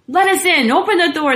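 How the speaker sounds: loud, high, forceful command; urgent storybook guerrilla demand; demanding shout. A narrator's reading. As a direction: shout to be let in. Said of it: "they demanded".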